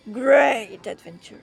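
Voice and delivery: harsh, slow punchy English; aggressive. Pretend. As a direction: bark it out aggressively. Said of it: "C'était hyper agressif".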